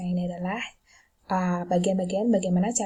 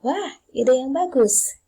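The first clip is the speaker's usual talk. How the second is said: "Wah, ide yang bagus" lifted high, slow and louder; raised intonation; 5 semitones higher, 6 dB louder, slower than usual. Shown as a demonstration. Teacher demonstrating accepting help, "Wah, ide yang bagus" in raised intonation. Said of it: "Naik ke atas intonasinya".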